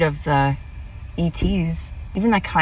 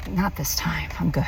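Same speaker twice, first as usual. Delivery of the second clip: whisper; 6 dB quieter than usual. Retold or imitated. Declining the alien's ride offer.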